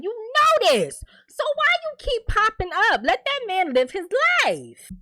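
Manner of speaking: high, exasperated scolding at her. Real, not acted. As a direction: scold her like she should know better. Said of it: "lecturing the ex".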